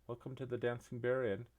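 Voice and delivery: high, chirpy barmaid greeting. Acted. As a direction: greet them like a cheery barmaid. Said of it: "She goes".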